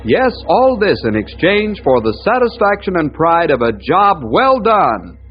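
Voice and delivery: put-on punchy, rolling old-time show intro; dramatic radio announcer. Announcer introducing the guardian of the forest, dramatic. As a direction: announce each danger with ringing emphasis.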